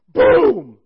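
sudden loud, high boom; shouted sound effect of lightning; imitated thunderclap. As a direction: burst out with an explosive boom.

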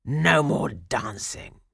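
slow, forceful pirate voice; gloating pirate. A narrator's reading. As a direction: lay down the order word by word. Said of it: "he said, and laughed again".

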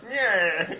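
high, loud drawn-out zombie cry; zombie impression. Asked for best zombie impression, loud silly moan. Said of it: "do your best zombie impression".

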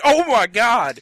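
loud, high, taken-aback outburst; startled exclamation. Retold or imitated. Customer reacting to being yelled at, startled. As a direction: blurt it out, re-enacting your shock. His startled reply to the yell.

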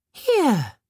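high bright fairy; fairy presenting. A narrator's reading. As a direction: hand it over proudly. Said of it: "said the birch fairy".

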